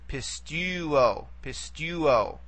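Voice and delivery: pistuo, slowly, with the weight on "tu"; stress on penult. Shown as a demonstration. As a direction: lean on the middle syllable of "pistuo". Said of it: "only can go back one vowel from the nearest long".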